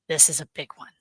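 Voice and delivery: conspiratorial whisper, hushed and secretive. Acted. Host teasing viewers about a big show, whispered. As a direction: let them in on a secret under your breath.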